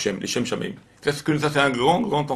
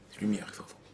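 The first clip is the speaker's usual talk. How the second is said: whispered, much softer, full of wonder; awed whisper; 2 semitones lower, 13 dB quieter, faster than usual. A narrator's reading. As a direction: hush them with the miracle.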